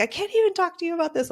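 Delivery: husband exasperated. Retold or imitated. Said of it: "He's like".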